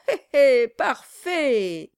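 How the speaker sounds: slow, oily, fawning; obsequious chuckle. Acted. Brass fawns over the exhausted dwarf, oily and slow. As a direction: ooze flattery.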